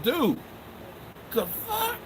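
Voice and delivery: very high, squeaky incredulous reaction; mock disbelief. Host retells reacting to being called names, high mock disbelief. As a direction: act out stunned disbelief way up high.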